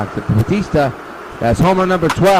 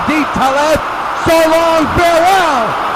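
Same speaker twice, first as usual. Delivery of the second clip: home run call; 7 semitones higher than usual. Pretend. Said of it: "the home run call".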